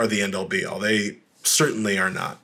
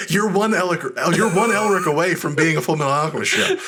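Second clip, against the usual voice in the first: laughing; 6 semitones higher than usual. Real, not acted. Laughing through a joke.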